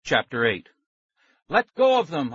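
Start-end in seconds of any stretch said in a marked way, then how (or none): 1.5-2.3 high, loud demand; shout. A narrator's reading. Maxie demanding the man free the friends, shouting. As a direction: shout it at the grabber. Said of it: "I shouted".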